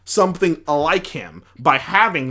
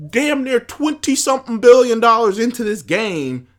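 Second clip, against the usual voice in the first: higher-pitched, indignant rant; pissed off; 5 semitones higher than usual. Real, not acted. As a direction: throw it out in exasperated disbelief. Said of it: "The thing that pisses me off".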